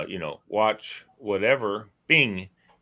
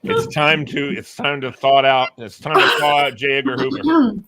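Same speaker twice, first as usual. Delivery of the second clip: dramatic proclamation; 4 semitones higher, 8 dB louder than usual. Acted. Hammed-up spy announcement.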